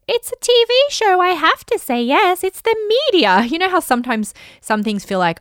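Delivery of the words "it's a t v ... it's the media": much higher and louder, anxious mock inner voice; mock panicked thought. Pregnant author reacting to TV invite, mock panicked squeal. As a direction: squeal it like a flustered eager-to-please self.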